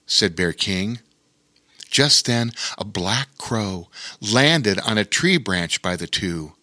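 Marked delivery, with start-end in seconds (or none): none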